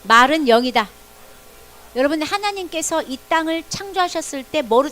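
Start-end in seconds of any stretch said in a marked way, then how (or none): 0.0-0.9 said again much louder; loud repeat. Shown as a demonstration. Children repeat after the pastor, loud proclamation. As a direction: proclaim it loud the second time. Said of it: "다시 따라하세요".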